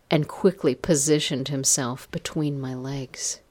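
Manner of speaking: ordinary delivery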